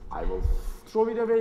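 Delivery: ordinary talk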